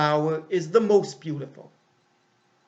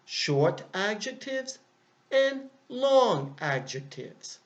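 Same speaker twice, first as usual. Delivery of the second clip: slow teacher emphasis; 5 semitones higher, slower than usual. Shown as a demonstration. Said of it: "we have two kinds of adjectives".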